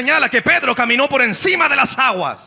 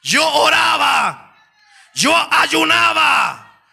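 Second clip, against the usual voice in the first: full-force preaching; 6 semitones higher than usual. Acted. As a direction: preach it with mounting fire.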